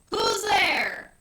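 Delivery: high loud shout into the dark; hollering. A narrator's reading. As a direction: yell out scared and bold. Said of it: "Tommy hollered".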